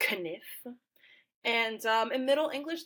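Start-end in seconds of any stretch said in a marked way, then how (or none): 0.1-0.7 "kniff" with the K sounded and a short i; joking mispronunciation. Shown as a demonstration. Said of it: "in a joking way, you, you've pronounced it as".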